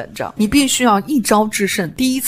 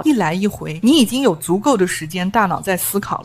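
normal talking voice

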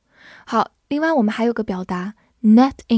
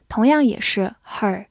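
usual speaking voice

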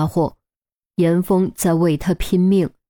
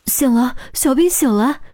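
overjoyed mother; 6 semitones higher than usual. Acted. Mother hears daughter awake.

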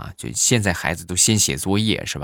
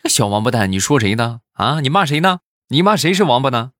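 rising higher and louder with each question; mock furious grandpa; 6 semitones higher, 4 dB louder than usual. Old grandpa yelling at his son after the kick, mock fury. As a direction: demand who he's calling names.

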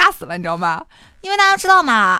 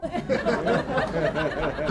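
laugh; 8 dB quieter than usual. Real, not acted. Laughs at the punchline.

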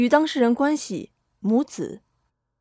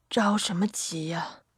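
low, slow, husky near-whisper; hushed and hoarse, drawn out; dry, hoarse voice; 4 semitones lower, 7 dB quieter than usual. A narrator's reading. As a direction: say it slowly in a parched old woman's croak. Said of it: "吴桂花干哑的声音".

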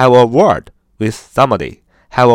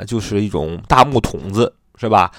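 ordinary talk, nothing marked

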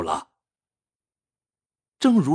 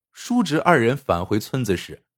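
ordinary delivery

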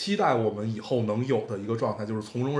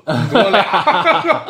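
laughing, slow and louder; 11 semitones higher, 9 dB louder, much slower than usual. Real, not acted. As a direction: laugh through the words. Teasing co-host, laughing.

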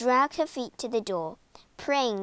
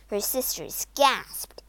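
ordinary delivery

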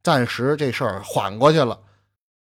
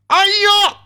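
sudden high gasping cry of horror; a child's startled scream at the sight; shocked cry; 8 dB louder than usual. Acted. Boy sees mother hanged. (惊恐的尖叫)

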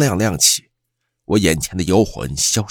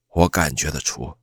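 plain reading voice

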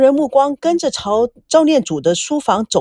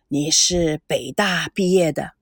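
put-on slow, low old man's voice, softer; an elderly grandfather probing slowly, lower; 6 semitones lower, 3 dB quieter than usual. A narrator's reading. Grandfather questions granddaughter's boyfriend, slow and low.

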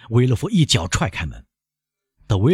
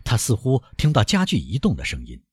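ordinary delivery, nothing marked

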